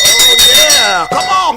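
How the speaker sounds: loud, high hype shout; big radio-host cheer; excited whoop. Real, not acted. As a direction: fire up the listeners. Radio host hyping the morning.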